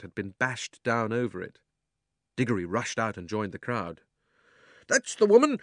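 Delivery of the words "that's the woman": high, loud accusing shout; furious shopkeeper crying out. A narrator's reading. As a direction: yell it out, pointing at the thief. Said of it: "cried the fat man".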